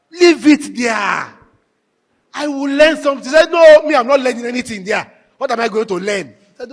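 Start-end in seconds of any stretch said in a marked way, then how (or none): 0.1-3.2 insisting. Retold or imitated. Insisting to wife in car. 3.5-6.3 loud, higher protest; retold protest. Wife refuses to listen to the preaching station, loud protest.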